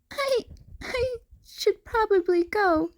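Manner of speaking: high, wet-voiced and emotional; teary. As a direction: hold back tears as you leave. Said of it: "sounded wet with emotion".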